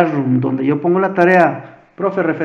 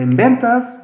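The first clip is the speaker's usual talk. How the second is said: ventas called out higher and louder; little shout; 6 semitones higher, 4 dB louder, slower than usual. Shown as a demonstration. Teacher showing how Excel shouts the sheet name, mock little shout. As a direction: give ventas a small playful yell, like an exclamation mark. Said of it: "pero gritadito".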